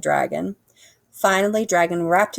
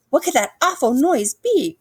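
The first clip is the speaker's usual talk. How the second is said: higher, anxious cry; scared Dragon; 8 semitones higher, 4 dB louder than usual. A narrator's reading. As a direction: fret in the dark. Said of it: "cried Dragon".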